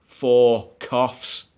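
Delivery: low, slow driver's voice; gruff bus driver. Retold or imitated. Passenger retelling the driver refusing him, low and slow. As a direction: drop low and drag it out like a stern driver.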